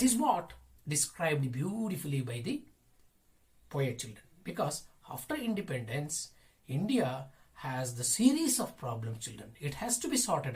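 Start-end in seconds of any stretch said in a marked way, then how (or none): none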